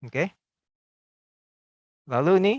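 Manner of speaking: ordinary talk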